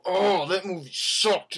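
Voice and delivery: slow, drawn-out scoff at the movie; exaggerated moviegoer complaint; mock disgusted outburst. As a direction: ham up storming out hating it. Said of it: "walk out and go".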